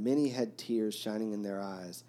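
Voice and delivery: ordinary delivery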